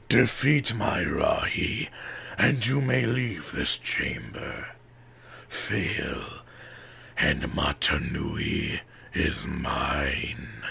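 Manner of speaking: slow, whispered, menacing villain; a threat breathed from the shadows; Makuta's voice. A narrator's reading. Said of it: "Makuta replied".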